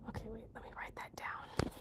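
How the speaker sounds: very soft, voiceless aside; whisper to self. Real, not acted. Writing the new word down, whispering to self. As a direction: whisper it as you make a note. Talking to self while noting.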